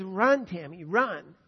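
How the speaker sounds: loud call from the sidelines; mother yelling encouragement to her tiring daughter near the finish; shouted encouragement. Retold or imitated. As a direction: shout it to spur your running daughter on. Mom urging daughter in race.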